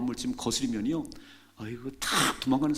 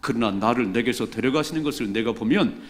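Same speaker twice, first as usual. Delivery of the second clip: scripture reading; 2 semitones lower, 7 dB louder than usual. Real, not acted. Congregation reads scripture aloud.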